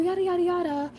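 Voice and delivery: high, singled-out blah-blah mimicry; mocking mimicry. Storyteller mimicking a classmate's rant about her, high mocking voice.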